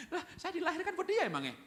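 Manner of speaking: high-pitched acted protest; mock indignant child. Child fed up living for papa, mock indignant protest.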